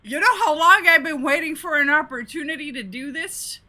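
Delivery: loud, high gleeful yell; excited shout. Real, not acted. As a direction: shout it out in triumph. Gleeful over changing title.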